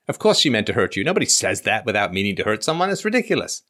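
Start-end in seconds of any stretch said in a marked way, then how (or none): none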